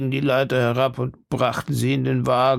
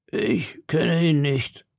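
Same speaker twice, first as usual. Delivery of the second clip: lightly voiced old man, higher and slow; slow, raised voice for an old man; 4 semitones higher, slower than usual. A narrator's reading. Old man answering D'Artagnan's question, lightly voiced.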